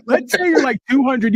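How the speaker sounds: high mock Jamaican accent with giggles; giggly hammed-up Jamaican accent. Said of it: "I'm doing the Jamaican now".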